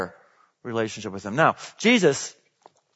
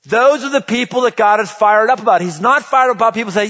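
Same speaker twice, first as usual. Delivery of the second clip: raised, driving preacher's voice; preaching at full force; 5 semitones higher, 5 dB louder than usual. Acted. Pastor rousing the church about change, full-force preaching. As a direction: push it out to the whole congregation.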